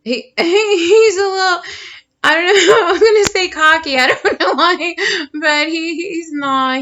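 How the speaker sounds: high, loud giggles breaking through the words; can't stop laughing while describing him; giggling. Real, not acted. Reader giggling at the cute turtle card, laughing.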